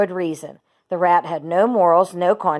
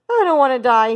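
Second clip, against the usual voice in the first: high, louder tearful moan; a pig weeping about death; 7 semitones higher, 7 dB louder than usual. A narrator's reading. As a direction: Wilbur moans in tears. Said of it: "he moaned".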